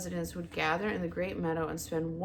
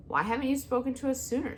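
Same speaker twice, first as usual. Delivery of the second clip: screeching owl; 6 semitones higher, faster than usual. Acted. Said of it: "screeched the owl".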